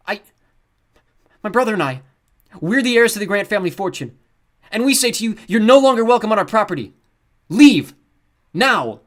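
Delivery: loud, high furious order to leave; commanding heir. A narrator's reading. Luke ordering the guards to leave. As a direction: throw them off the property. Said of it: "trying to sound grief-stricken and horrified".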